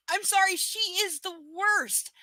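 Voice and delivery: usual speaking voice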